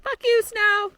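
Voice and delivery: high, loud yell at the snow; shouted curse. Real, not acted. Cursing the snow on trail.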